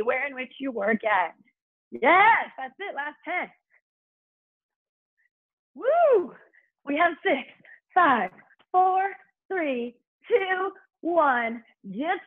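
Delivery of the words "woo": high-pitched excited woo; high woo cheer. Real, not acted. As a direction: whoop the class on. Cheer during the set.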